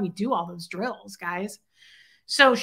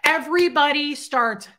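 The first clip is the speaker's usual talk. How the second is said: usual speaking voice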